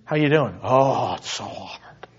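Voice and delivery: acted weary sigh, sinking low and breathy. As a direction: play a worn-out believer losing all voice. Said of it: "their gospel is bad news".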